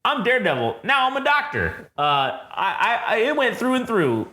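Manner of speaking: high, cheery know-it-all voice; chirpy mock Daredevil. Mocking Daredevil as a cheerful instant doctor, high. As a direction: play it smug and perky. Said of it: "So he's like".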